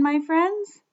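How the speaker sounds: ordinary talk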